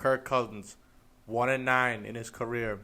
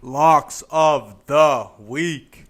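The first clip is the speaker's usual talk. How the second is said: very loud, drawn-out segment title; announcer voice; 3 semitones higher, 13 dB louder, much slower than usual. Pretend. Co-host introducing betting segment, booming announcer voice. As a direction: boom it out slowly like an announcer.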